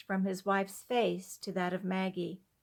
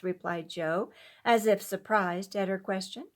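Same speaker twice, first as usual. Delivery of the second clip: ordinary delivery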